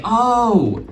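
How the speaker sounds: drawn-out sympathetic oh; pitying realization. Retold or imitated. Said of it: "they're just like".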